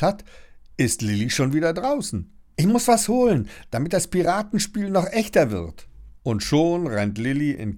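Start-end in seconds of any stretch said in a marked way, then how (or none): 2.6-5.9 excited. A narrator's reading. Girl excited calling.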